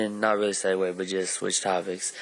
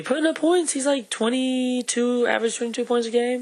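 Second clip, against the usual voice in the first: excited; 13 semitones higher than usual. Real, not acted. Excited about stats.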